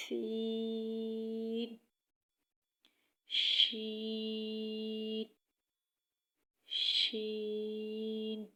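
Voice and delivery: سین and شین drawn out very slowly with a whistle; long hissing seen. Shown as a demonstration. Teacher modelling long letters. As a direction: stretch the hiss of سین long. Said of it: "تھوڑا لمبا کر کے پڑھیں".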